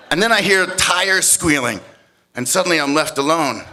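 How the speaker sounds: ordinary talk, nothing marked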